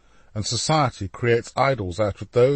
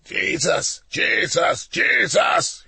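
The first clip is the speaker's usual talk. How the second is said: rising yells, each higher; yelling, building; 8 semitones higher, 3 dB louder, much slower than usual. A narrator's reading. Alessandro prowls the studio yelling his name, building. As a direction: build from shout to wild high cry. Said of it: "he yelled".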